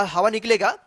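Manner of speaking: usual speaking voice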